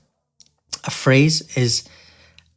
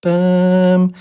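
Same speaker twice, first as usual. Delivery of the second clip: a long, held-out "Bum", a bit higher and louder; long syllable; 5 semitones higher, 5 dB louder than usual. Shown as a demonstration. Teacher demoing a long syllable, held-out "Bum". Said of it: "that second syllable is longer".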